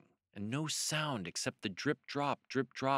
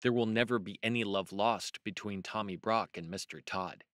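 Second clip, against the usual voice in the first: ordinary delivery, nothing marked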